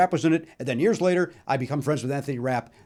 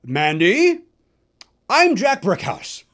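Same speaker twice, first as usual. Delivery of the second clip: big, higher, slow announcer voice, loud; broadcaster voice; 4 semitones higher, 6 dB louder, much slower than usual. Retold or imitated. A fan greets the star at the stage door in a broadcaster voice. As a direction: boom it out like an old radio broadcaster. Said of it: "with a very broadcastery voice".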